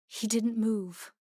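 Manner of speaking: ordinary delivery, nothing marked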